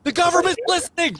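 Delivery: high yell with a laugh in it; mock panic. Warning the group about the alarm, high laughing mock panic. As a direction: cry out in pretend alarm.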